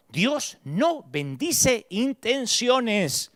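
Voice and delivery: very slow, each word weighed on "no"; slow, deliberate. Shown as a demonstration. Preacher drilling a key truth, very slow. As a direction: drag "Dios no bendice intenciones" out word by word. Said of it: "Quiero que te grabes esto".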